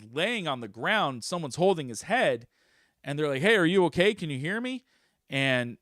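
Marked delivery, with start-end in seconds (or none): 3.4-4.9 raised, high urgent questioning; acted alarm, louder at the start; concerned bystander. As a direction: call to someone coming round, pitched up. Voices bystanders rousing fallen man.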